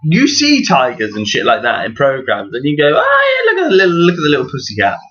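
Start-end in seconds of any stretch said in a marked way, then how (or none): 3.0-5.0 high squeal dropping into a quick coo; mock cooing. Viewer fawning over tigers on television, mock cooing. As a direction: gush over a cute kitty.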